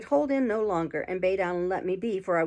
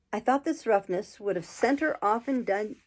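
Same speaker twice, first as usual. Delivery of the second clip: ordinary delivery, nothing marked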